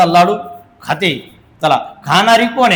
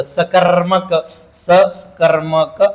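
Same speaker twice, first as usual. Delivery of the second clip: drawn-out term; much slower than usual. Shown as a demonstration. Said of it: "teacher stretches term".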